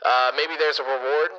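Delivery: echoey store PA page; PA announcement. Pretend. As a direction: page it over the intercom. PA page repeats line.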